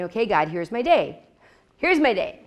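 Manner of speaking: brisk, loud handing over of the day; mock eager offering. Young believer telling God, mock eager and loud. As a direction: hand God your day on your terms.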